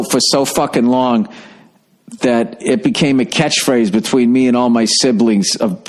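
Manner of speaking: usual speaking voice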